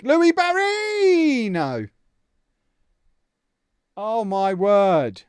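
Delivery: loud, high and slow exasperation; real exasperated shouting; frustrated outburst. Real, not acted. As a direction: wail at the broken game in disbelief. Game crashed again, frustrated.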